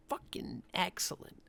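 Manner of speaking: whispered in hushed awe; awed whisper. Real, not acted. Podcast host praising the episode, hushed awed whisper. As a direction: breathe it out like it's sacred.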